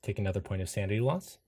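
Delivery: ordinary talk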